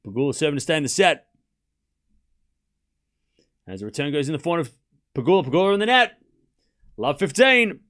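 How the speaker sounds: brisk, loud play-by-play call; tennis commentary voice. Acted. Streamer commentating the match for chat in a commentator voice. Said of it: "putting on my on-stream voice".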